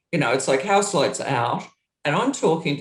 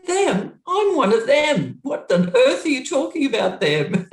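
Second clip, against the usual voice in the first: incredulous; 10 semitones higher, 4 dB louder than usual. Retold or imitated. Arguing with Iggy in dressing room.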